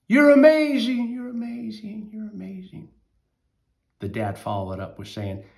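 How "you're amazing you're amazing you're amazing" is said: high loud praise that fades each repeat; cheering across the canyon, fading echo; joyful shout fading to echo. Acted. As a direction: shout it out and let it ring away. Boy shouts praise, echo returns.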